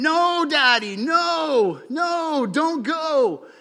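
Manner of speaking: put-on high, loud pleading little boy; crying child. Little boy at the airport begs his dad, high loud wail. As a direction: wail like a three-year-old clinging to dad's leg. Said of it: "just starts saying".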